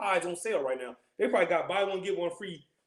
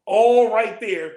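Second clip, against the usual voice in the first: "All right there" slow and much louder; slow emphatic; 4 semitones higher, 13 dB louder, much slower than usual. Real, not acted. Stresses the store layout.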